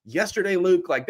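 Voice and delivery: normal talking voice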